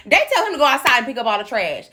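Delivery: ordinary talk, nothing marked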